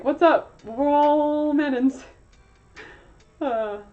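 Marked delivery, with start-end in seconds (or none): 0.0-2.0 higher and much louder, slow on the second line; hammed-up Mannon reveler. Relatives at the convention greeting each other, loud mock cheer. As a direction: call it out like a cheesy family reunion greeter. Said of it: "is like".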